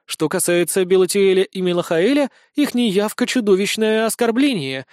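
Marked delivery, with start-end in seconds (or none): none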